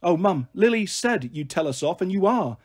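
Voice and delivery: ordinary delivery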